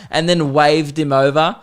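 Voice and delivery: normal talking voice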